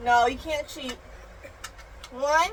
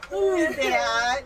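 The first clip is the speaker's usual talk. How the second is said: normal talking voice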